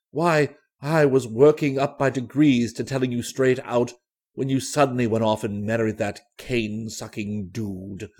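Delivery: put-on low, reproachful old flame; deep-pitched, insistent pleading; earnest old suitor. A narrator's reading. Plays Danby, old suitor of Julie.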